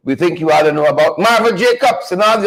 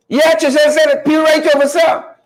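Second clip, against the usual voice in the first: excited outburst; 6 semitones higher than usual. Real, not acted. Host excitedly shouting a listener's map correction to the audience. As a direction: blurt it out, suddenly fired up.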